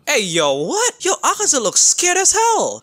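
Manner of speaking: high-pitched, astonished exclamation; shocked outburst. Real, not acted. Fan seeing Akaza look scared, shocked outburst. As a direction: blurt it out high in disbelief. Shocked reaction to the villain cowering.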